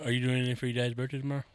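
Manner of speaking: ordinary talk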